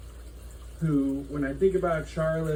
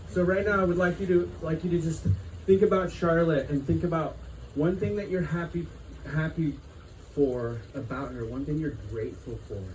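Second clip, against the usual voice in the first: guided reflection; 3 dB quieter than usual. Acted. Leads a gratitude reflection.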